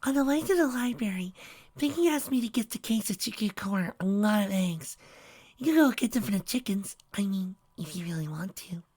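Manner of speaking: high, soft shy pony voice; timid Fluttershy. A narrator's reading. Fluttershy timidly asking Trixie to fetch eggs, in a soft high voice. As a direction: speak like a shy gentle pony.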